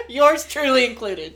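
loud, laughing, high; laughing confession. Real, not acted.

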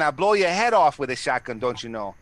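put-on loud, jokey Minnesota accent, gangster bluster. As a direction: play a folksy Minnesota thug bragging in a thick Minnesota accent. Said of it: "that Minnesota accent".

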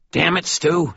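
high, irritated snarl. Acted. Maroon snarling at Stu for coming down again. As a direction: snap at the intruder. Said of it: "he snarled".

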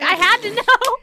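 loud exclamation. Real, not acted. Confessing to her co-host, a loud giddy shout. Confessing she took the quiz.

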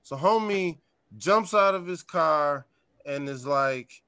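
ordinary talk, nothing marked

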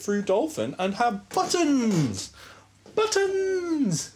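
excited. Real, not acted. Excited about buttons.

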